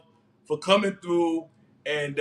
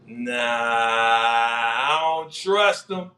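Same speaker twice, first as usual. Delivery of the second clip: slow, drawn-out distrust; 5 semitones lower, much slower than usual. Real, not acted. Host doubting Unique, very slow and drawn out. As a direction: drag each word out slowly.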